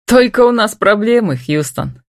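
ordinary delivery

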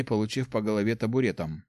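ordinary delivery

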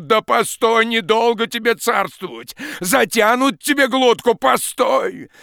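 high, loud, reckless shouting; nothing left to lose, scream it out; despairing furious yell. Acted. Ruined peasant shouting at the forester, despairing furious yell.

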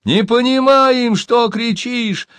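high, drawn-out distant reply; storyteller voicing a far-off shout; calling back. A narrator's reading. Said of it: "отозвались из-за чистокола".

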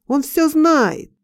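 slow cry, pitched high; accusing cry. A narrator's reading. Passers-by pointing at the dreamer, high drawn-out cry. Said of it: "и кричат".

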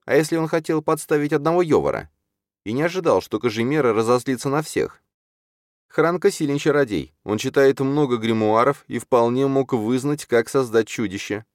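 high, urgent character voice; urgent Чарна. Acted. Чарна stops Юрген to warn about Йовар, urgent. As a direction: make the case earnestly, pitched well up.

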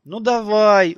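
high, loud drawn-out coaxing. A narrator's reading. Nicole persuading hesitant Fili, coaxing. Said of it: "уговаривала она".

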